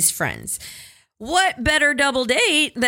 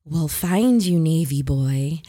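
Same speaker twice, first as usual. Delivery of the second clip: slow, low creepy taunt; 4 semitones lower, slower than usual. Retold or imitated. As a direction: drawl it like a lurking ghoul. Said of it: "this, like, creepy voice trails after us".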